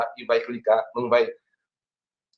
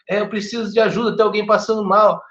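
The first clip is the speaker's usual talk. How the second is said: higher-pitched, hurried mock plea for help; imitated emergency caller; 7 semitones higher than usual. Teacher imitating people calling emergency services, higher-pitched mock caller. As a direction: lightly act out a caller asking for help. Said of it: "elas falam assim".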